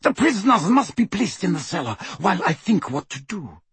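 high-pitched, agitated command. A narrator's reading. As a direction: order them about urgently. Bellatrix gives orders, agitated.

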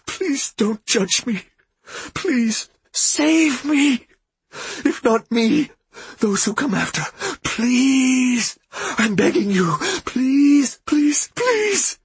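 high, slow broken begging; whimpering. A narrator's reading. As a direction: plead through tears, desperate. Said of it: "His every word was a whimper now".

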